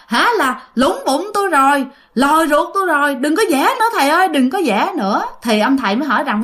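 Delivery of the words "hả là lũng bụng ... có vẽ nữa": high-pitched, hurried mock pleading; retold outcry, pitched up and urgent; crying out. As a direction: imitate a spirit yelping for it to stop. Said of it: "tự nhiên la lên nói rằng".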